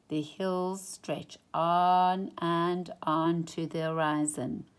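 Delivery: plain reading voice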